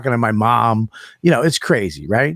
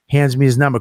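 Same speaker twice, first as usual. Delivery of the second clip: ordinary talk, nothing marked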